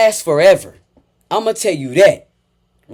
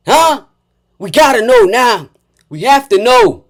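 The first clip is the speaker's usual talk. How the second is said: loud, raised-pitch angry call-out; angry rant; 7 semitones higher, 10 dB louder than usual. Real, not acted. Host calling out a man for mocking another's job, angry. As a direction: lay into him, loud and high.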